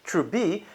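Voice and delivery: Trubi with an open bee ending; dropped final t. Shown as a demonstration. The person who was told to buy, Trubi without final t. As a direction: cut the t from the end of Trubi. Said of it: "was told to buy Truebit".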